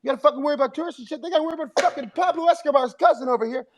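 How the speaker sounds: high mock Burr riffing on tourists; Bill Burr roast. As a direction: roast the guy in the crowd, high and quick. Imitating Bill Burr roasting him.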